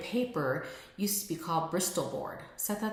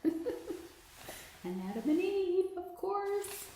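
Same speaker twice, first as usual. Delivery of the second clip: much higher, bubbly with a laugh; very excited; 13 semitones higher, much slower than usual. Real, not acted. Excitedly showing viewers a new sample pattern. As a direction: gush over the pattern, pitch way up. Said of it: "Very excited".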